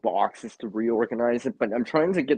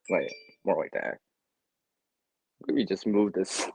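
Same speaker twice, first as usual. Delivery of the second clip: ordinary talk, nothing marked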